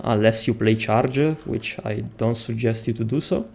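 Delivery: ordinary delivery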